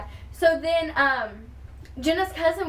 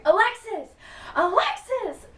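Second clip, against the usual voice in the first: calling out a name; 8 semitones higher than usual. Retold or imitated. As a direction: shout her name to get attention. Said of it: "I hear somebody calling my name".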